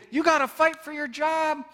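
mock the pushy well-wishers; pushy advice.

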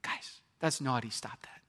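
whispered mock scolding; tame, whispery telling-off; mild G-rated shushing. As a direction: hush them softly, as if gently telling off naughty children. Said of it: "the G version here".

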